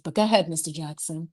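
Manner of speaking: lightly voiced Aunt Bev urging him on; Aunt Bev impression. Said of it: "I may not be Aunt Bev".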